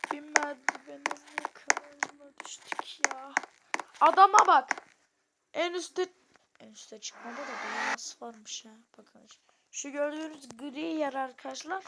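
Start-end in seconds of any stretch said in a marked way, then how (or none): 4.0-6.1 high loud outburst; loud excited exclamation. Real, not acted. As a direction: burst out in disbelief.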